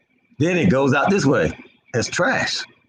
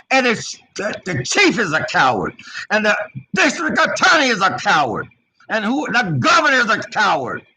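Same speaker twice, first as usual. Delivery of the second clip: angry denunciation; 6 semitones higher than usual. Real, not acted. Denouncing officials as cowards.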